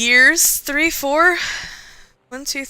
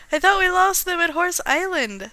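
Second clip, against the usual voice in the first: raised, higher storybook yell; 6 semitones higher, 4 dB louder than usual. Acted. Captain calls to her first mate about pursuers, yelled. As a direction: call it out across the deck. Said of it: "She yelled".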